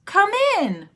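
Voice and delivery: high, bright welcome to the rabbi; cheery old granny. Acted. Old granny greeting the rabbi at her door, high and delighted. Granny invites guest inside.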